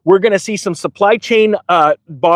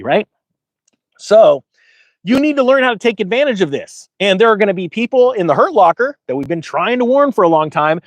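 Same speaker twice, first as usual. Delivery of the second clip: ordinary talk, nothing marked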